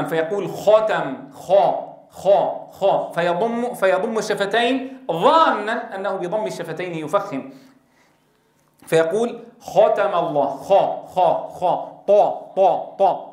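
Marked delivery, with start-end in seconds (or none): 0.5-3.1 "ختم" and "خا" with lips rounded toward u; slow rounded "خا"; rounded fatha. Shown as a demonstration. المعلم يقلد خطأ ضم الشفتين في "خا". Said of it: "يضمون الشفتين عند المفتوح". 9.6-13.3 "خا" and "طا" with lips rounded toward u; rounded fatha. Shown as a demonstration. مثال خاطئ: "خا" و"طا" بشفاه مضمومة. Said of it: "وهذا كله خطأ".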